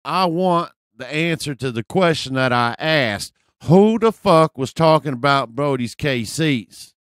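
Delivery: imitated belligerent hollering; confrontational guy impression. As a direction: press for an answer as the tough guy. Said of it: "do my best impression of this guy".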